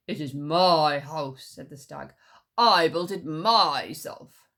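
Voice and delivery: loud, slow low insistence; angry stag. Acted. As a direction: stubbornly claim it. Quarrel over house.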